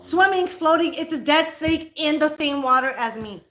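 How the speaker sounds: fed-up correction, pushed up high; exasperated protest. Real, not acted. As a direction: insist over the objection. Arguing with interrupting partner.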